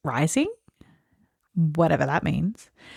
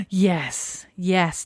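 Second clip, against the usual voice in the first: excited gasp. Real, not acted. Gasp at correct answer.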